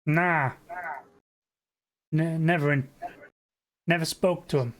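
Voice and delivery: put-on dismissive New York accent. Neighbour denying he knew the missing person, New York accent. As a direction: brush it off in New Yorkese. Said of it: "his thick New York accent".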